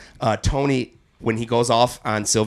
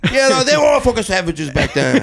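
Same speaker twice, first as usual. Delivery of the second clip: higher, louder, still hamming the bigot; mock outraged rant; 11 semitones higher, 5 dB louder than usual. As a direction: keep up the fake indignation.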